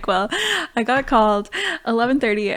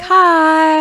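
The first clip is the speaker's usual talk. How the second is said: casual labourer greeting; 6 semitones higher, 7 dB louder than usual. Retold or imitated. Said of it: "someone would get on the phone and be like".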